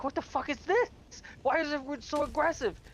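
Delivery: high-pitched, scandalized complaint; mock outrage. Teabagged opponents protesting to the squad, mock outrage.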